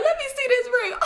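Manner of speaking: high, laughing through the words; laughing uncle. Retold or imitated. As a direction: crack up asking for the ring. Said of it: "He's like".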